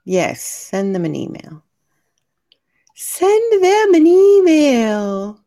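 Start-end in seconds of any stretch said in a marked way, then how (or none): 2.9-5.4 high, loud, stretched-out singsong call; playful loud drawn-out cheer; drawn-out urging. Pretend. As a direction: call it out slowly, pitched well up.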